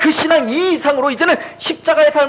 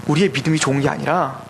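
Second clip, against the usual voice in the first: usual speaking voice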